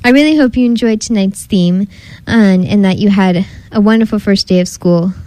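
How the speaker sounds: ordinary delivery, nothing marked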